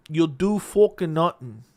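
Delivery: slow, put-on Irish accent; Conor McGregor accent. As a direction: give it your best stretched mock Irish. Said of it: "That's the best I got".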